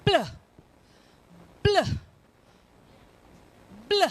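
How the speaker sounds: short, isolated "pl, pl, bl" with long gaps; blend demonstration. Shown as a demonstration. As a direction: fire off each "bl" as one quick blend. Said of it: "when you say".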